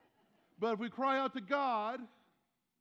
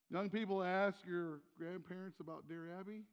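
ordinary talk, nothing marked

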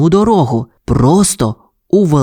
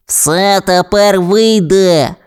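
lightly voiced pup, slow and higher; Кремез; 5 semitones higher, much slower than usual. A narrator's reading. Pup nods to Ryder, slow character voice. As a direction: draw it out as a pup.

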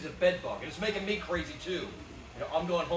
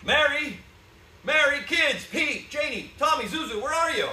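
higher, louder desperate calls through the house; calling out; 8 semitones higher, 8 dB louder than usual. Acted. Husband calling wife and kids through the empty house, loud. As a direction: shout their names into empty rooms.

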